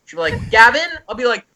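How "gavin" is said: high, louder questioning call; lightly voiced mother calling a name; mom calling. Mom waking her sleeping son, high questioning call.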